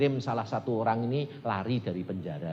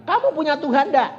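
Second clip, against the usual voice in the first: raised, high-pitched demand; loud challenge; 11 dB louder than usual. Retold or imitated. Preacher challenges his frightened boatman about God, loud and high. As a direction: challenge a frightened man forcefully.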